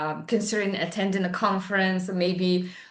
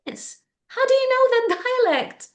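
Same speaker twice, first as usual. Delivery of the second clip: student's question; 14 semitones higher, 3 dB louder than usual. Retold or imitated. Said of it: "She told me".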